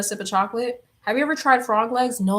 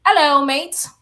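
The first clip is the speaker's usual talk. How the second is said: loud, higher mock London greeting; botched London accent; 5 semitones higher, 7 dB louder than usual. Streamer greeting a viewer from London, botched London accent. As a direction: playfully put on a bad London accent. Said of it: "fucked up that accent".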